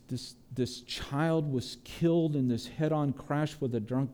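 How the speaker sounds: quiet, aching prayer, repeating "I don't understand"; a hurting believer's hushed lament; pleading prayer. Acted. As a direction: pour it out to God softly but urgently. Voices a sufferer's prayer.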